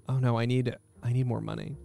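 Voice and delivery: normal talking voice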